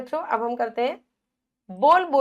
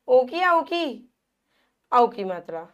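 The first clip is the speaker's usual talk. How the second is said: ordinary talk, nothing marked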